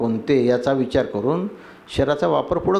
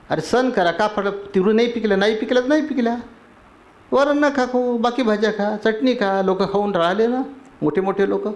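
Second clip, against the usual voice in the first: exhorting; 7 semitones higher than usual. Acted. Acted advice to complainer about food.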